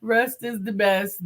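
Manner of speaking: usual speaking voice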